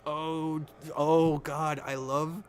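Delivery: loud, high gush of delight; overwhelmed fan exclamation; thrilled outburst. Real, not acted. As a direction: burst out loving it. Thrilled by the show's teasing.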